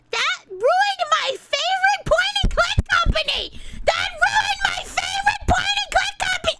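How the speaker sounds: high, loud outraged rant; yelling. Real, not acted. Streamer ranting about a favourite game company ruined, yelling. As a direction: yell it in exasperation, pitch way up. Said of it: "I can't be yelling".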